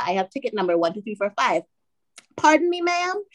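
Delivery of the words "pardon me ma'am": high-pitched polite query; imitated station clerk. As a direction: play a puzzled phone clerk, voice pitched up. Police station clerk on phone.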